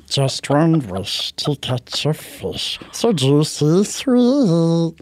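slow creeping creature voice; sing-song Gollum hunger. Retold or imitated. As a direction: croon about the fish like Gollum. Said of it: "I was Smeagol".

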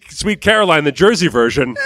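ordinary talk

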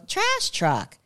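put-on high toddler voice naming a bus. Son reading his truck book, high childish naming. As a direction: chirp it like a small boy. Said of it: "he'd go".

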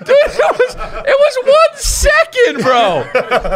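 high, breathless laughing words; laughing hard. Real, not acted. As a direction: crack up while talking. Laughing at Nick eating chip.

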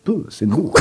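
mock Spanish accent; Antonio Banderas. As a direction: say it like a Spanish-accented cartoon cat. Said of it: "Antonio Banderas sort of accent".

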